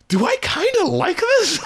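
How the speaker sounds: high, slow, doubtful mock question; puzzled self-questioning. Listener surprised by own taste, mock puzzled. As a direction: ask yourself in squeaky disbelief. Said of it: "I was sort of going like".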